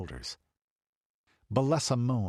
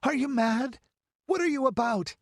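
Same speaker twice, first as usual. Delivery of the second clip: high, indignant demand; scandalised high-voiced cry; outraged bewilderment; 4 dB louder than usual. A narrator's reading. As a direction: challenge in shocked disbelief, pitched way up. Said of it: "cried Balessa in outraged bewilderment".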